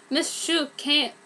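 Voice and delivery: ordinary delivery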